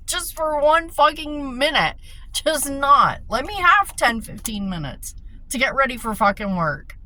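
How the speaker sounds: yelling at her dad to let her get ready for work; snapping at dad. Retold or imitated. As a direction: vent the fury of a cornered caregiver. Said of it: "I just snapped".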